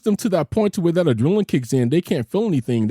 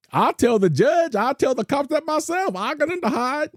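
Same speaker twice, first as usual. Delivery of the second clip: retold defiance; 8 semitones higher than usual. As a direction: replay standing up to a threat, pitch climbing. Retells own defiant reply to threat.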